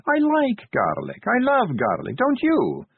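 high, slow, sugary taunting; sweet. A narrator's reading. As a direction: lay on the sweetness, menacing. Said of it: "said Sally sweetly".

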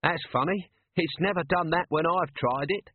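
Nobby's joke-telling voice, high; a punchline delivered with a storyteller's relish; storytelling mode. Acted. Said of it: "voice and tone of Corporal Nobbs in storytelling mode".

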